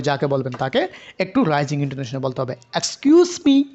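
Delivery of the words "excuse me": "Excuse me" called out high and louder, pitch lifting; loud rising call. Shown as a demonstration. Teacher showing how to call for attention. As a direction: call out to catch someone's attention. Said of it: "একটু loudly বলি".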